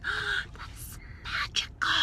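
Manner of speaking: high, whispered amazement; awed whisper. Retold or imitated. Vlogger amazed the computer is already there, whispering. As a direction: gasp it out in wonder. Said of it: "I'm like, gasps".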